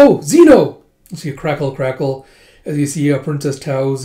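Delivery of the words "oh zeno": high, loud exclamation; alarmed call. Acted. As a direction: call after someone running off. Acted call after running Zeno.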